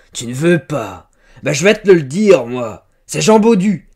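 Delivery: loud, high, insistent shouting; angry accusation. A narrator's reading. Farmer naming a suspected lover, angrily. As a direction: build to a triumphant shouted guess.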